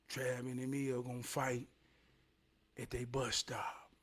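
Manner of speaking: whispered gossip in the hallway; hushed rumor. Retold or imitated. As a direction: breathe it like a secret rumor. Rumor spreading through hallways.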